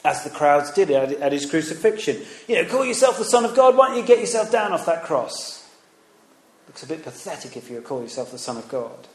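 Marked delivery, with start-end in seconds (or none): 2.7-5.6 high, loud taunting jeer; mocking jeer. Preacher voicing the crowd at the crucifixion, loud mocking jeer. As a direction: sneer it up at the cross. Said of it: "Or you could mock Jesus".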